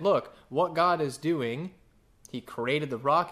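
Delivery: usual speaking voice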